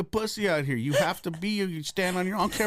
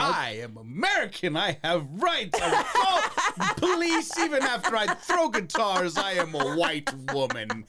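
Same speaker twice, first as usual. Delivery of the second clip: lightly put-on indignant protest, slow and drawn out; hammed-up entitled complaint, breaking into laughs; mock entitled woman; 5 semitones higher, slower than usual. Mocks entitled woman calling police.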